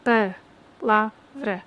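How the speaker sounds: "Pa-la-vra" split into three slow syllables. Shown as a demonstration. Teacher splitting palavra into syllables slowly. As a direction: tap out each syllable slowly. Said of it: "tem três sílabas".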